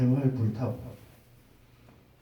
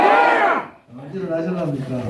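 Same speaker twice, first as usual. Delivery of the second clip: rallying call; 5 semitones higher, 5 dB louder, slower than usual. Real, not acted. Rally leader calls to crowd.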